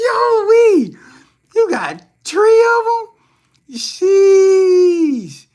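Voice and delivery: very high, loud shrieks with cries; squealing hype at Sharon's three men; shrieking. Real, not acted. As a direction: scream it out in disbelief. Stunned by viewer's three men.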